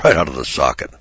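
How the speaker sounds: ordinary talk, nothing marked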